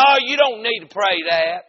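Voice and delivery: loud, high protest; retold hollering. Man interrupting the preacher's prayer, hollering. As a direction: holler over his praying. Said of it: "he started hollering while I was praying".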